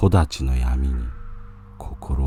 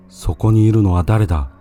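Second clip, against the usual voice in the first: higher, louder, quick call; startled challenge; 5 semitones higher, 5 dB louder, faster than usual. Acted. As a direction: call out sharply into the dark. Startled monk challenges figure.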